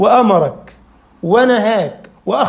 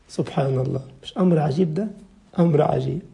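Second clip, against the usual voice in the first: low and soft in awe; hushed wonder; 6 semitones lower, 7 dB quieter than usual. Real, not acted. The preacher marvelling at the atheist's logic, low and hushed. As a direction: drop to a low quiet marvel. Awed quiet after peak.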